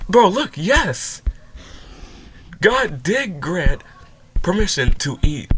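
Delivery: loud, slow, insistent rebuttal; exasperated outburst. Real, not acted. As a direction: drive each word home, fed up. Heated argument with video claim.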